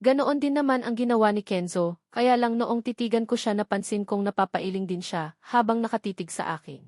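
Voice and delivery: ordinary delivery, nothing marked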